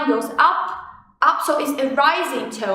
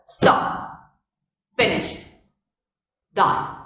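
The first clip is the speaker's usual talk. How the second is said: "Stop", "finished", "die" short and final; abrupt, separated "Stop, finished, die"; falling tone; 5 semitones lower, slower than usual. Shown as a demonstration. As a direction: cut each word off as if no future is left. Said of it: "very short and very fast".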